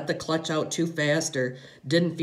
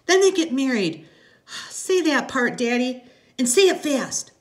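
put-on high, loud child's voice; small daughter's excited demand; eager little girl; 11 semitones higher, 6 dB louder than usual. A narrator's reading. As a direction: beg Daddy high and loud. Little girl begs for more rhyme.

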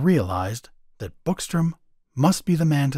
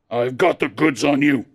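cold; 2 semitones higher, 4 dB louder than usual. A narrator's reading. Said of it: "Clagett spoke coldly".

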